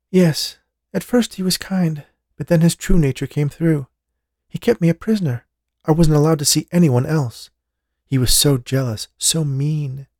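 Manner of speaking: lightly voiced young woman in fear; a woman pleading about her lover; frightened woman. A narrator's reading. Jessica voiced as character.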